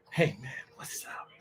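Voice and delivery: hushed greeting, barely voiced; whispering. Real, not acted. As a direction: whisper it so no one hears. Quiet aside greeting.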